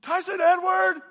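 full-volume, high mom holler; imitated mom summoning her kid; mom yelling. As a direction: yell both names so they echo down the block. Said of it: "echoing through the neighborhood".

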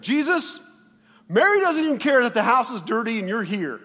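loud, high mock complaint; indignant tattling. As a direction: tattle on your sister in hammed-up outrage. Martha tattles on her sister.

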